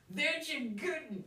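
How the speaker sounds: high, slow folksy drawl; lightly voiced backwoods codger, amused; old woodsman chuckling. Rancid laughing off the request, in folksy voice. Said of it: "he said".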